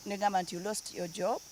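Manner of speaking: ordinary talk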